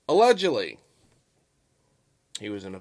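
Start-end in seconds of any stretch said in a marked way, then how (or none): 0.1-0.8 "Allegedly" punched loud and high, then falling; emphatic allegedly. Real, not acted. Vlogger stressing his fairness to viewers, emphatic allegedly. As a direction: lean hard on "Allegedly", pitch leaping up. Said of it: "I emphasize the word allegedly".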